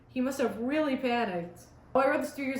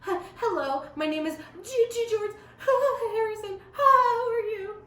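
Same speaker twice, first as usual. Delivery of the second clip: hammed-up panicky introduction, tripping over the name; shaky, nervous; 10 semitones higher, 4 dB louder than usual. A narrator's reading. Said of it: "says in a shaky, nervous voice".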